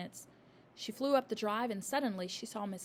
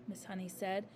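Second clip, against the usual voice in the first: ordinary delivery, nothing marked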